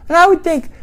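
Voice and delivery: ordinary talk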